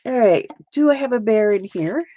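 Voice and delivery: ordinary talk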